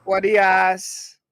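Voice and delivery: loud, slow mock Jamaican exclaim; drawn-out put-on Jamaican accent. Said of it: "that's not a Jamaican accent".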